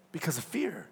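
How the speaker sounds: hushed, breathy close of the sentence; soft whispered ending. Real, not acted. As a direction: let the words fall to a whisper. Preacher drops to whisper.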